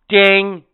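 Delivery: single loud, high ding; imitated bell. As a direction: mimic the bell with one sudden ring.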